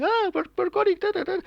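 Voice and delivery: high-pitched imitation of girls yelling through megaphones; mock screaming. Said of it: "The girls on the hill with megaphones screaming".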